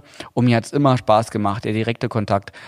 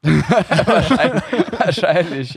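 giggling through the words; laughing; 5 semitones higher, much slower than usual. Real, not acted. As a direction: laugh it out, high and slow. Laughs at the calorie joke.

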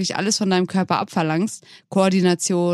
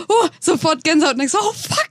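wincing reaction; 9 semitones higher than usual. Retold or imitated. Acts out wincing at film pain.

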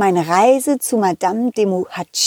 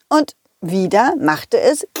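plain reading voice

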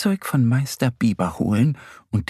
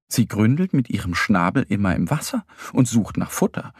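usual speaking voice

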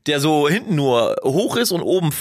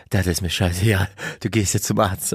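low mock voice of a firm wife; lightly voiced wife; 8 semitones lower than usual. Wife sending her stubborn husband to the doctor, lightly imitated low voice. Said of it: "sodass meine Frau heute gesagt hat".

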